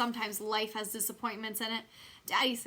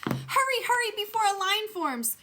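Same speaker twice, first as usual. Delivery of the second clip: much higher and louder hurried urging; urgent excitement; 11 semitones higher, 7 dB louder than usual. A narrator's reading. Junie B rushing Lucille to meet the twins, eager urging. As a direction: hurry her along, bursting with eagerness.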